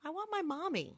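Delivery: put-on high, very soft little-boy voice; small child's plea. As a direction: plead quietly like a small child. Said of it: "and then he goes".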